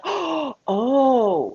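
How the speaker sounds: mock sudden understanding; a light retold gasp and oh; gasp of realization. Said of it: "And I was like".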